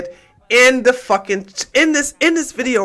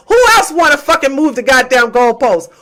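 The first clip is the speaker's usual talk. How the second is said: usual speaking voice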